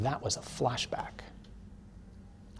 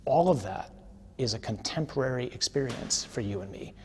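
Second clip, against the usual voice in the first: ordinary talk, nothing marked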